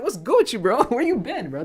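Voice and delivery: high, loud mock teasing from the Toronto kids. Toronto kids ribbing the hockey player, loud high mock teasing. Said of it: "They're like".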